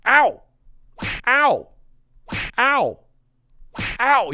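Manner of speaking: high, loud "ow" yelp; mock pain yelp. Skit character taking repeated hits, mock pain yelps. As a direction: yelp sharply as if struck. Yelps along with hit effects.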